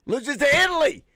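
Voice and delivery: loud, high cry of disbelief. Real, not acted. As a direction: shout it like you can't believe the score. Stunned at US losing to Italy.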